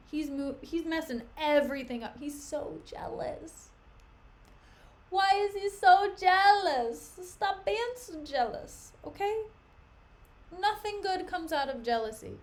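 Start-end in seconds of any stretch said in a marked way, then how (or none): 2.2-3.7 slow unvoiced whisper about his jealousy; soft unvoiced whisper; hushed aside. Real, not acted. Exasperated aside about Othello. 5.1-9.4 slow, higher, louder plea; loud slow exasperated call; exasperated scolding. Real, not acted.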